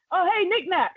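high-pitched mock call-out; retold shout. DJ hailing the support act, mock shout. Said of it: "he actually shouts".

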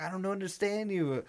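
high-pitched imitated protest; mock dramatic confusion. Eugene to friends explaining the cramp, mock dramatic. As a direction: play up the baffled friend, lightly. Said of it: "acting dramatic".

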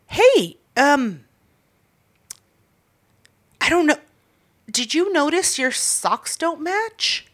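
high, hesitant, slow voice; imitating a fussy feminine man; feminine guy. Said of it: "it was a feminine guy".

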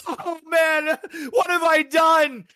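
very high, hammed-up wail of regret; miserable regret. Both partners when reality sinks in, mock miserable wail. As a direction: wail it, dragging out the last words. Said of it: "they're both miserable".

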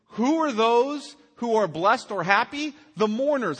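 preacher challenging the listeners; emphatic preaching. Acted. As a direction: press the question on the congregation. Rhetorical question to congregation.

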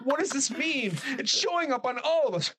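a horror extra freaking out, half laughing; panicked high voice. Acted. Panicked movie victims.

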